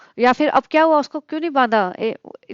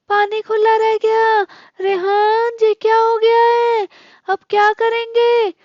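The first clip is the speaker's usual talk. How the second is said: much higher, slow whiny put-on voice blaming everyone for the flood; mock accusing panic; 10 semitones higher, 4 dB louder, slower than usual. Mocking the vlogger blaming her mother and grandmother, high whiny mock panic. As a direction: ham up a dramatic girl wailing at family over spilled water. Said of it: "सब पे इल्जाम लगाती फिर रही थी".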